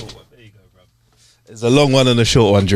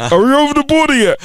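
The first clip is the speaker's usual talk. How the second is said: high muffled voice from a trunk. Acted. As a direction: call out muffled from the car boot.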